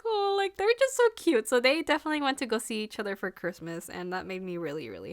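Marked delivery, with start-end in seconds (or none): 0.0-1.5 high excited squeal of delight. Retold or imitated. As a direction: squeal "Oh" over something cute. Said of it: "And I'm just like".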